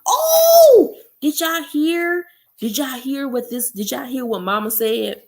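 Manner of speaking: high, laughing exclamation that settles back down; excited outburst. Real, not acted. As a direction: burst in thrilled and amused, then calm a little. Reader bursts out reacting to book.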